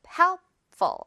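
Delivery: emphatic drill. Shown as a demonstration.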